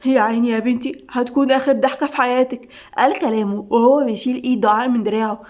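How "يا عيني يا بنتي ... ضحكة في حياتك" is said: ordinary delivery, nothing marked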